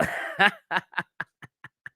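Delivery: a hearty chuckle at his own punchline; amused laughter after the joke; real laugh. Real, not acted.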